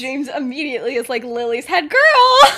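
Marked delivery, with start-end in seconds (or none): 1.2-2.5 loud, high, drawn-out shout; excited announcement. Acted. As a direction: blurt out the big news, laughing. James blurts out the news.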